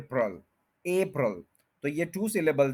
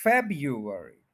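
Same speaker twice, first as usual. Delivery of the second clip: February slowly, stressed FEB-yu-er-ee; first-syllable stress; 2 semitones lower, much slower than usual. Shown as a demonstration. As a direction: hit FEB first in February, then fall away. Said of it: "first के ऊपर stress है". (February, पहले syllable पर ज़ोर)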